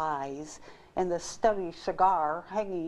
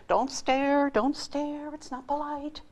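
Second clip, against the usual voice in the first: inner scolding; 9 semitones higher, 3 dB louder, slower than usual. Acted. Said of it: "he thought to himself".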